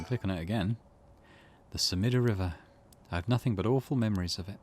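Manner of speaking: ordinary talk, nothing marked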